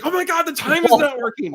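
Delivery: high, loud mock-panicked cry; acted-out panic. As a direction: freak out comically, pitch shooting up. Acts out paranoid panic while high.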